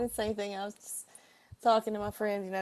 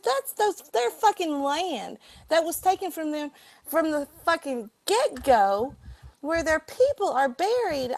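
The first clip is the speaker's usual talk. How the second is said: much higher and louder, heated; furious retold rant; 7 semitones higher, 7 dB louder than usual. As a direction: lash out in outrage over the land. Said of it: "I said".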